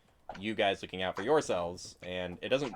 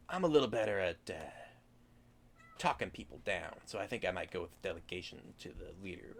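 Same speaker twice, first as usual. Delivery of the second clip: lightly voiced hobgoblin, fading softer; a hint of a sly goblin, trailing off; gravelly sly hobgoblin; 4 dB quieter than usual. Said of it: "The hobgoblin turns and goes".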